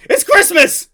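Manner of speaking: loud, high exclamation; exclaiming. Real, not acted. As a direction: call it out emphatically. Correcting the video loudly.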